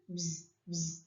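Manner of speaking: low, soft buzzing like a bee. Shown as a demonstration. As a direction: hum it like a bee, low and quiet. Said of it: "What does it sound like?".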